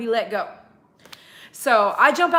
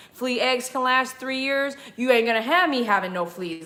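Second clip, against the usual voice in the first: normal talking voice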